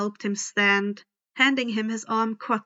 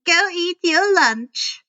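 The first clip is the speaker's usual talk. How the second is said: stern teacher; 7 semitones higher, 6 dB louder than usual. A narrator's reading. Miss Dutz dismisses Grover.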